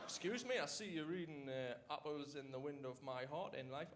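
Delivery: mock-smooth London chat-up, lightly voiced; suave Londoner, imitated. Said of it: "suave and smooth and all that".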